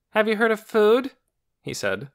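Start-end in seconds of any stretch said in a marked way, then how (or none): 0.1-1.1 high, loud mocking question; teasing. A narrator's reading. Boy teasing sleepy Lena at dinner, playful mock. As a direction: needle her with a teasing jab. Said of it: "and he laughed".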